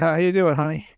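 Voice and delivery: covering up in a normal, audible voice; mock-innocent greeting. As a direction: say it openly, as if nothing was muttered. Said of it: "I said".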